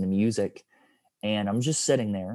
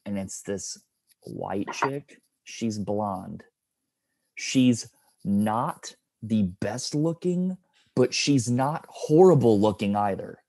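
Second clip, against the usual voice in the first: ordinary talk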